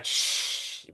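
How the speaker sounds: unvoiced shush; a quick shh to silence a child. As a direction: hush someone sharply. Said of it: "mi papá me manda a callar".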